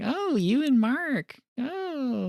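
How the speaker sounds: high, drawn-out giggly tease; giggly gossip. Retold or imitated. Friend finds out about the affair, giggly and teasing. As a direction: coo it high and slow, mock-scandalized. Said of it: "she's all giggly".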